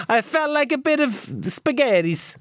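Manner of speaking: slow, high mock Italian accent; fake foreign language. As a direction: put on a hammy Italian voice, slowly. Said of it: "I was gonna say chorizo".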